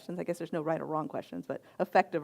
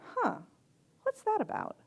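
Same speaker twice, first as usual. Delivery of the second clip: a much higher, musing huh; softer, curious inner question; curious wondering; 13 semitones higher, 4 dB quieter than usual. Shown as a demonstration. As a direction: puzzle it over aloud, quietly intrigued. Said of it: "to look at it and go".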